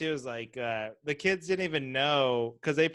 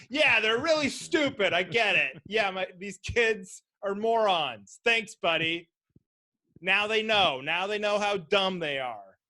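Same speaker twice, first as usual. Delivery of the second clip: higher, slow, sarcastic voice; mock sarcastic dad; 5 semitones higher, 3 dB louder, slower than usual. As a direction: overdo the sarcasm to the kids. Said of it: "you had to call attention to it".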